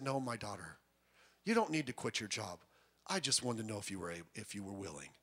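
low, soft mock divine voice; lightly voiced God speaking softly to a daughter. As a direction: hush it like a gentle, solemn God. God voiced in mocked testimony.

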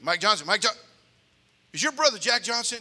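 teacher leaning in, curious and loud. Retold or imitated. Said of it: "He goes".

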